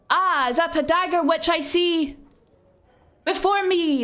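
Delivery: high, theatrical Macbeth line; hammed-up Shakespeare recital. Shown as a demonstration. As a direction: overplay it like a bad stage actor. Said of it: "it wasn't obviously like that".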